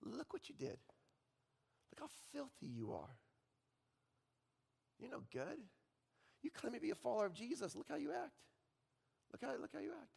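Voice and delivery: a devil hissing shame into your ear; hushed Satan. Acted. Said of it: "And he says".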